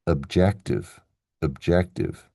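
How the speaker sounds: "objective" with a weak schwa opening and strong second syllable; second-syllable stress. Shown as a demonstration. Teacher contrasts similar spellings, "objective" stressed on the second syllable. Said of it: "stress is supposed to be on the second syllable".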